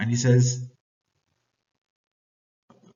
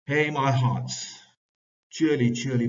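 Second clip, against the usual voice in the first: rally the sailors over the storm; hearty bosun cheering his crew on; bosun calling crew; 4 semitones higher, 4 dB louder than usual. Acted. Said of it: "So bosun says".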